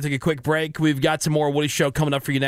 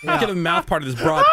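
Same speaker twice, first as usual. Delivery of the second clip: normal talking voice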